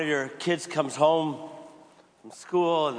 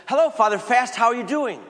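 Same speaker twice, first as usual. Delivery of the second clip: put-on much higher, louder child's hello; a little boy blurting the nickname; cheerful kid voice; eager kid greeting; 7 semitones higher, 6 dB louder than usual. Kid greeting the priest brightly.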